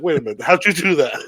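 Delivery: confused. Retold or imitated. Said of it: "Lex would be so confused".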